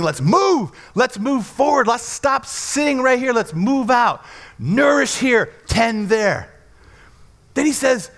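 loud, high, driving sermon voice; fiery preaching. Acted. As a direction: preach it out full force. Preaching at full force.

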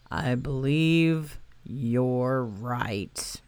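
low, very slow sad donkey voice; a resigned old donkey giving up. Acted. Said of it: "sad sigh".

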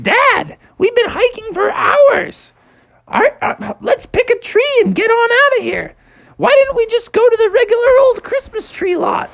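very high squeaky little frog voice, whining; a tiny frog complaining to his dad, high-pitched; Froggy. Acted. Little Froggy whining at Dad in a very high voice.

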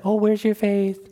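lightly mocking a gentle question; sweet mock version. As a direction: ask it sugary and gentle, not stern. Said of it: "he wasn't saying that very nice, like".

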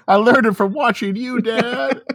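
put-on high tearful kid. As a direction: play a guilty kid. Quoting PSA kid.

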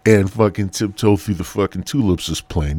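ordinary talk

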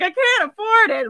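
high laughing whimper of a mock whine; half-laughing fake cry. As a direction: whine it like a helpless child.

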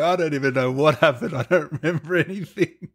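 giggly imitation of a dazed actor; laughing mock quote. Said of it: "you're going".

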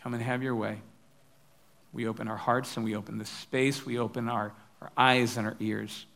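low, soft praying voice; prayer. Real, not acted. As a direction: pray quietly and unhurriedly to God. Opens with a prayer.

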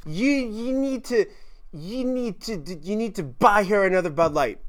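imitating drunk girl. Drunk girl demanding a beer.

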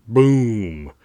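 loud, high explosion word; storyteller's booming sound effect; big boom. A narrator's reading. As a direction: boom it out like a blast. Explosion word in story read aloud.

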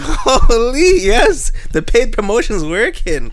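high and slow, savouring the win; hyped exclaim. Retold or imitated. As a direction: gloat happily, stretching it out. Hyped about barber recognizing ribs.

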